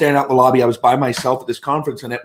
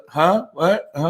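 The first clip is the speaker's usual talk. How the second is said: higher-pitched, questioning interjections; distracted, pitched-up brush-off; can't-hear-you act; 5 semitones higher, slower than usual. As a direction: play someone half-hearing through headphones. Mimics man feigning deafness under headphones.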